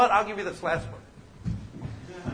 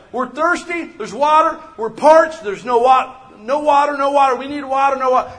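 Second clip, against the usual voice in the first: higher, louder whining Israelites; thirsty complaining crowd; 7 semitones higher, 11 dB louder than usual. Retold or imitated. Preacher voicing Israelites begging for water, high and loud. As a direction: cry out for water, pitched up.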